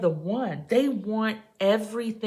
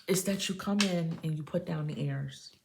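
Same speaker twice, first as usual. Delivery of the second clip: usual speaking voice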